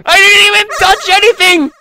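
high-pitched shout of disbelief; shrieked protest. Acted. Player protesting the game moved by itself, shrieked protest. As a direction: shriek your innocence.

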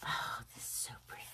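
quick, breathless whisper of delight; fast hushed admiration. Real, not acted. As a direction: gasp it out under your breath. Whispering over pretty napkin.

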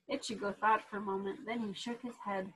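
plain reading voice

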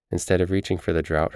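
usual speaking voice

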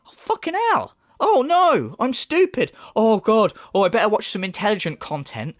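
high, wailing mock dismay; hammed-up horrified whine; mock distressed viewer. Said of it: "Does he really think anyone's gonna go".

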